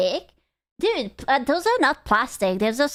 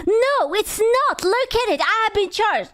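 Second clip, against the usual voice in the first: mock indignant viewer; 8 semitones higher than usual. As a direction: ham up the pushy complainer who swears he paid. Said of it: "And I was like".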